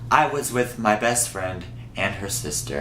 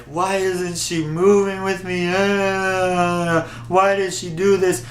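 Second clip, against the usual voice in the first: higher, imitating her own wailing; mock sobbing; 7 semitones higher than usual. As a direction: replay the sob, half-hammed. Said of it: "I would be sobbing like".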